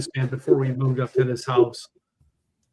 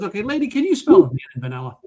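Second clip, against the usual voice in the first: a hint of an irritated clerk, higher and louder; annoyed clerk; 6 semitones higher, 4 dB louder than usual. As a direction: clerk losing patience with lady. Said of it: "He's so mad at her".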